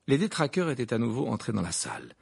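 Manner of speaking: plain reading voice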